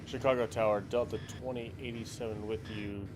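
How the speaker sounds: ordinary delivery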